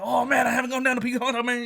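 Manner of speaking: high, rapid Louisiana patter; run-together imitated Louisiana accent; Louisiana cook impression. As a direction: mimic the fast-talking Louisiana cook. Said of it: "he's talking like that".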